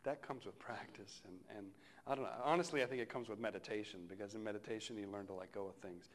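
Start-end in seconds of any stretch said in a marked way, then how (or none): none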